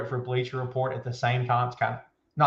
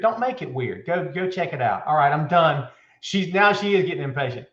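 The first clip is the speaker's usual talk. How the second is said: ordinary talk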